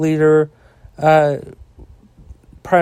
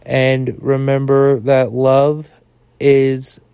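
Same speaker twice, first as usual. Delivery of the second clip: lower, smooth sponsor-read voice; a friendly host reading the sponsor spot; podcast ad read; 3 semitones lower than usual. Acted. Host thanking listeners and pitching the sponsor, ad-read voice.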